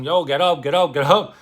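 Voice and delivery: mock urging to wake up; urgent calling. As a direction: rouse a passed-out drunk. Dimitri shaking the drunk awake.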